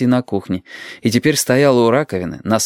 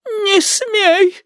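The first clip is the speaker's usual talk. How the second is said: very high, feeble granny cry; thin high protest, no real volume; helpless cry. A narrator's reading. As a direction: cry out powerlessly as an old woman, pitch right up. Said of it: "бессильно закричала бабуля".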